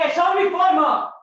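high, very loud yell; full-voiced heated outburst; angry shouting. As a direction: shout it slowly in self-defence. Acted quarrel, shouted.

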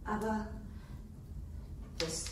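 hushed, breathless whisper of fear; scared half-whisper over the wrapped object; frightened whisper. Acted. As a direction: whisper it as if afraid of being heard. Nurse frightened by hidden revolver.

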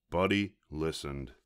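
usual speaking voice

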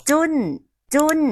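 "จุ้น" with j for g and n for ng; child's mispronunciation. Retold or imitated. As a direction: imitate a toddler's unclear กุ้ง as "จุ้น". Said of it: "แบบนี้ใช่ไหมคะ".

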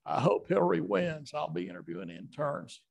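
light Bill Clinton voice; Clinton impression. Comedian showing Eddie his Clinton, lightly voiced Bill Clinton. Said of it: "I'll do Clinton".

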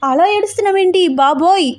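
high-pitched, flustered pleading; narrated panic, voice jumping high. A narrator's reading. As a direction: panic at someone suddenly crying. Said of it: "తెగ కంగారు పడిపోతూ".

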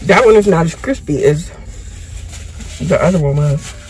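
speaking between bites; talking while chewing. Real, not acted. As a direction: mouth full of food. Seen 0: Bites a food item at 0.9, then chews while talking throughout.

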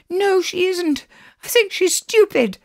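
put-on very high child's voice; squeaky-high little sister talking back; defiant little girl. A narrator's reading. Alice answers from under the eiderdown, high and defiant. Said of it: "her head still under the eiderdown".

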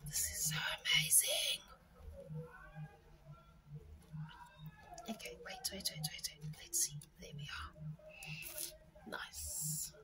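soft whisper while concentrating; whispering while fiddling with tiny stickers. Real, not acted. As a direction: hush it, barely breathing the words.

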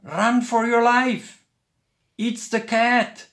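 high, loud alarmed warning; crying out. A narrator's reading. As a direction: yell the warning in panic. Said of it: "he cried".

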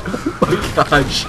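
breathless laugh; wheezing laugh through soreness; laughing through pain. Real, not acted. Laughing after a car hit.

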